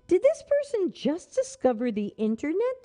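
mocking disbelief, rising; incredulous. Shown as a demonstration. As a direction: scoff at how late they are. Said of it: "that's what I wanna say".

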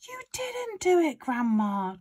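put-on high-pitched boy protesting, dropping at the end; shouting back. A narrator's reading. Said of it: "George shouted back to her".

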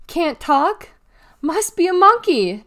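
high, loud schoolyard jeer; loud, high-pitched mocking call; taunting yell. A narrator's reading. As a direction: yell it to get the crowd laughing. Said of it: "Novello yelled".

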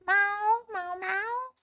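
high-pitched playful kitty meows; cat meow. Pretend. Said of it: "I am on my cat form today".

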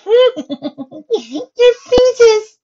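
put-on very high, loud laughing girl; howling with laughter. A narrator's reading. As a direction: howl it through helpless laughter. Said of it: "howled Mabel".

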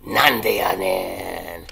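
low, slow and loud; deep booming delivery. Shown as a demonstration. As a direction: boom it out like a big announcer. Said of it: "and that word is".

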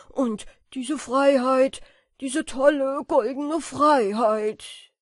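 loud, rapturous cry of delight; jubilant. A narrator's reading. Moth dancing through the attic, loud and jubilant. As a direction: shout out your joy at flying. Moths rejoice while dancing.

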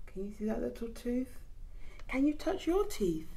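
ordinary delivery

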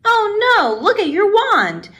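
high, dismayed "oh no"; dismayed exclamation. A narrator's reading. Peter to friend over the broken wand, dismayed.

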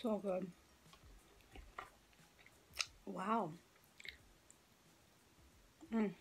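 chewing dessert; talking between bites; mouth full. Real, not acted. Seen 1: Spoons cookie and ice cream, chewing with lips closed between words.

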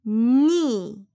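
long, strong 니; teacher modelling a drawn-out 니; demonstrated syllable. Shown as a demonstration. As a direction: hold the 니 as a stressed "nee". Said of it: "발음하세요".

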